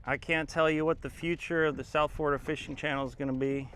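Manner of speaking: ordinary talk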